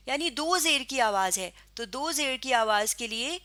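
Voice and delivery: ordinary talk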